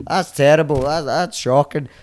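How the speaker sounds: loud, high outraged Irish tut; outraged Irish onlooker. Retold or imitated. Irish onlooker outraged at naked Billy, loud Irish scold. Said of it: "just being like".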